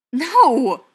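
loud, high outburst; exclaimed. A narrator's reading. Ryan refusing Brendan's warning, exclaiming loudly. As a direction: shout the refusal sharply upward. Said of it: "he exclaimed".

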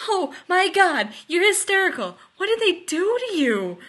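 very high, loud alarm; escalating panic. A narrator's reading. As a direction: cry out in frantic concern. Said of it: "made Rodney panic more".

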